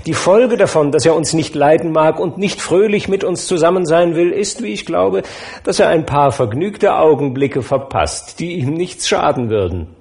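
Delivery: delighted host beaming at the table; bright jovial nephew; cheerful nephew. Acted.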